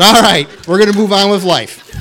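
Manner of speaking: high, loud, exclaimed retort; loud mock resignation. Husband brushing off wife's lie about her name, loud mock resignation. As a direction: call it out big for comic effect.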